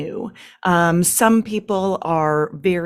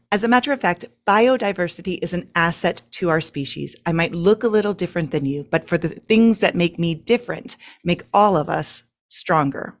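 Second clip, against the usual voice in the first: ordinary delivery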